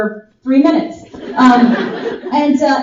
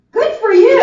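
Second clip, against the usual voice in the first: awkward compliment; 9 semitones higher, 4 dB louder than usual. Retold or imitated. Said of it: "He just went".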